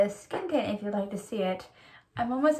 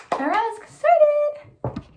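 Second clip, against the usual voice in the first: excited; 9 dB louder, slower than usual. Real, not acted. Cheerful announcement starting the tutorial.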